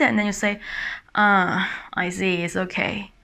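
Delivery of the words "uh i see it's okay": low, softening, let-down reply; disappointed. Acted. To a partner who cancelled tonight's date, mildly disappointed. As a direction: sink into quiet acceptance. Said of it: "you feel really upset and disappointed".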